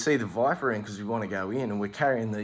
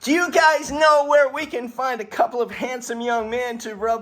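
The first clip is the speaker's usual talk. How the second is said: put-on bikini girls; 12 semitones higher, 6 dB louder than usual. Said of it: "they go".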